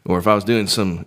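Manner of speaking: usual speaking voice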